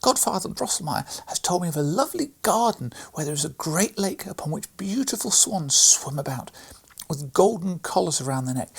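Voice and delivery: put-on high, loud, eager girl telling a tale; a small girl gushing about a magic garden; little girl's voice. A narrator's reading. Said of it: "cried Maria".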